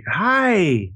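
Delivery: bright rising high hello, stretched out; high-pitched. Shown as a demonstration. Dad walking into the room to his daughter, high-pitched. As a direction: lift it high as if greeting a baby. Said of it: "like, really more high-pitched".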